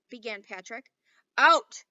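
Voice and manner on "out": very loud, higher roar; furious shout. A narrator's reading. Headmaster ordering the boys out, furious shout. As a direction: bellow it. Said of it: "shouted Mister Johnson furiously".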